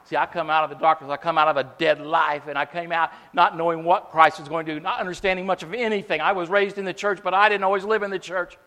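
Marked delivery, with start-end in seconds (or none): none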